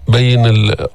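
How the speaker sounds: normal talking voice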